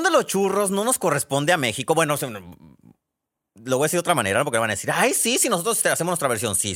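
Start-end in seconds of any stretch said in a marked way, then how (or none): 4.9-6.6 higher, mimicking a scoffing objector; lightly mock what listeners will say; mock objection. Podcaster imitating listeners who will object, mocking.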